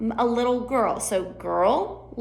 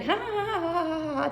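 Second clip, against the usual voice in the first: high, soft tittering ha ha; mock titter; tittering laugh; 8 semitones higher, 5 dB quieter than usual. Shown as a demonstration. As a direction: titter like a giggling jury. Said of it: "you're over there laughing".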